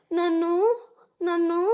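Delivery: halting, with a long gap between tries; hesitant stammer. A narrator's reading. Tearful sister unable to explain, hesitant stammer. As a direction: start to answer and stall. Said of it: "ఏం చెప్పకుండా నసుకుతుంటే".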